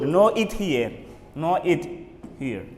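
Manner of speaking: usual speaking voice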